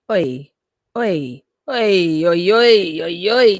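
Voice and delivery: loud repeated oi to grab attention; hollering for someone to notice; calling out. Real, not acted. Streamer shouting at chat, loud calling out.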